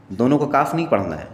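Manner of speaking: ordinary talk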